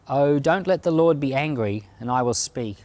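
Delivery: ordinary talk, nothing marked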